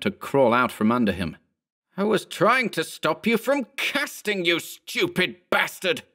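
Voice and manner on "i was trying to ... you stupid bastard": high exasperated outburst, slowing on the insult; angry exclamation. A narrator's reading. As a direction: exclaim it furiously, voice pitched up. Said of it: "Berman exclaimed".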